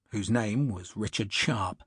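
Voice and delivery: ordinary delivery, nothing marked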